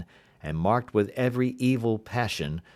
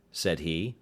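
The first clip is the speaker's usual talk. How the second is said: plain reading voice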